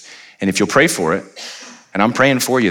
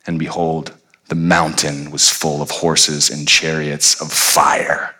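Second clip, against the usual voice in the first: usual speaking voice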